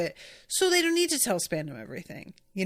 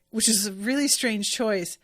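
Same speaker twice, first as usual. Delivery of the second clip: ordinary delivery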